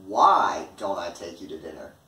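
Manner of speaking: "Why" long and loud, rest falling away; stress on WHY. Shown as a demonstration. Asking reasons for a rejection, stress on "Why". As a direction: punch and stretch the word "Why". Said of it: "put the focus on the why".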